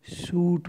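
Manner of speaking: शूट with a long drawn ऊ. Shown as a demonstration. Teacher models शूट for students with a long ऊ. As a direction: stretch the ऊ in शूट slowly. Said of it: "बड़े ऊ की मात्रा है".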